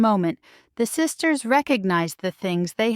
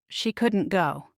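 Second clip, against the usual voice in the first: ordinary delivery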